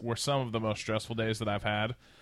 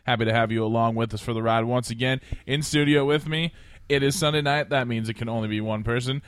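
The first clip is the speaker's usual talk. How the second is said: ordinary delivery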